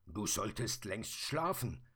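ordinary delivery, nothing marked

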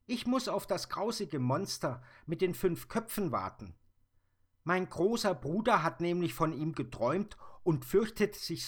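put-on brave little girl. Little girl telling the Sandman about the monster, high and loud. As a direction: speak as a bold small girl.

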